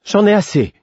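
high-pitched, fed-up outburst; exasperated cry. A narrator's reading. Ketriken fed up with her brother's act, exasperated cry. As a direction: cry out that you've had enough. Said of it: "s'écria Ketriken".

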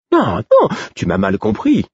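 a hint of earnest sincerity in a high jester voice; sincere. A narrator's reading. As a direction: reassure him urgently. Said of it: "Il y avait de la sincérité dans sa voix".

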